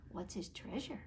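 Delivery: put-on soft curious whisper to the kids; hushed wonder. Shown as a demonstration. Storyteller asking children softly. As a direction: lean in and hush it. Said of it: "storyteller's hushed aside".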